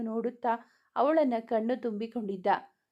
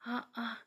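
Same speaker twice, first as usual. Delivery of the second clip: a quiet, hurting moan; soft groan of pain; 9 dB quieter than usual. A narrator's reading. Hero groans softly, his shoulder wounded. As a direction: let a small groan slip out. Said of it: "ಚಿಕ್ಕದಾಗಿ ನರಳಿದ".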